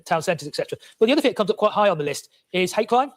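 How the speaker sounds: normal talking voice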